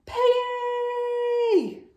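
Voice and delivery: high, loud calling out; yell. Acted. Gerald yells after Piggy, high and loud. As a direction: shout your friend's name.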